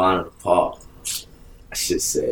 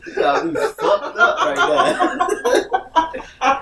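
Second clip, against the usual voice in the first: indignant rant broken up by laughter; laughing mock outrage; 12 semitones higher than usual. Man told they only want to cuddle, laughing outrage. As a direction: protest in disbelief while cracking up.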